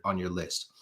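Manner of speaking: ordinary delivery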